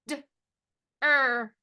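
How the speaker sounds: short D, pause, drawn-out "er"; sounded out. Shown as a demonstration. Teacher tapping the next syllable, sounded out. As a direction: split "D-er" into two separate sounds. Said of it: "Next syllable".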